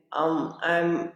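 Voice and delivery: normal talking voice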